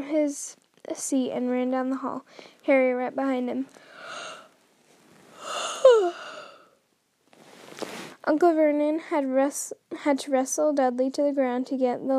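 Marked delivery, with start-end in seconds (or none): none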